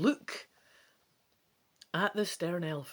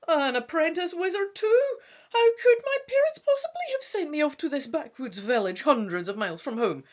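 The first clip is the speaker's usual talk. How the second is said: much higher, louder petulant whine; high indignant whine; whiny complaint; 11 semitones higher, 8 dB louder than usual. A narrator's reading. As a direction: whine like a spoiled prince sent away. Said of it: "you complain".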